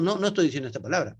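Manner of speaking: ordinary delivery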